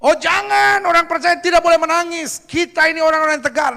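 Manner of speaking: mock protest. Preacher mimicking believers who forbid tears, mock protest. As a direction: object in an exaggerated high voice.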